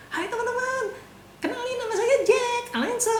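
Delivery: perky high-pitched hello; bright cheerful intro. Shown as a demonstration. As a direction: greet the room high and chirpy like a host. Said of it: "Akan beda gitu".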